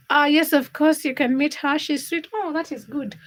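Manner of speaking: normal talking voice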